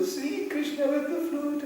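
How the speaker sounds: high, slow, dreamy sigh; mock dreamy devotion. Sentimental people picturing Krishna with the flute, mock dreamy gush.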